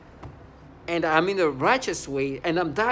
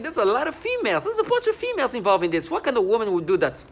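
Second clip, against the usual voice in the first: high mocking imitation of women, louder; 7 semitones higher, 6 dB louder than usual. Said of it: "Women are literally saying".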